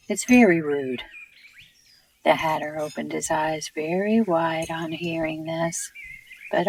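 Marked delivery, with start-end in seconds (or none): 0.1-1.0 louder, mildly stern reproof; some severity. A narrator's reading. Alice reproves the Hatter for personal remarks, mildly severe. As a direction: scold with a touch of severity. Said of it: "with some severity".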